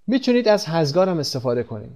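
ordinary delivery

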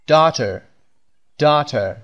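ordinary talk

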